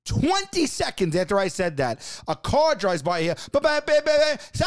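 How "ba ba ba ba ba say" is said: high garbled drive-by holler; imitated yell from a moving car; screamed from a car. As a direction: holler nonsense syllables high, as from a passing car. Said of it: "The person that just screamed".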